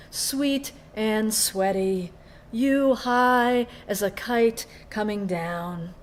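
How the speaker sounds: slow, measured poem delivery with long held words; poetry reading. Acted. Poet reads a love poem to an audience, slow, deliberate poetry reading. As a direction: read it as verse, each phrase set apart.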